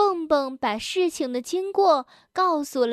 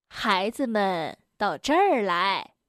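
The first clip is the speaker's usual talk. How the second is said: gentle granny; 5 semitones lower than usual. Acted. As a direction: gently beckon the little ones over. Granny calls the children over. (低缓慈祥的熊婆婆腔)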